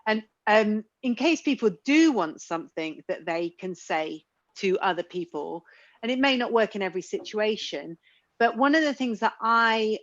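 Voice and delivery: normal talking voice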